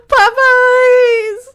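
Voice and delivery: high, loud, drawn-out fake wail; mock crying wail. Streamer mourning the shrimp po' boy, mock wailing. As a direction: wail it in hammed-up anguish. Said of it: "Where's my cry?".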